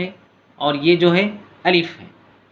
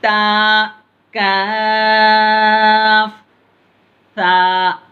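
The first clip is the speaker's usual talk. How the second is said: slow, high, louder chanted lam alif; drawn-out recitation; 5 semitones higher, 5 dB louder, much slower than usual. Shown as a demonstration. Teacher demonstrates the lesson's letters to pupils, slow chanted and louder. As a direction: hold लाम very long, then a falling अलिफ. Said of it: "तो ता अदा होता".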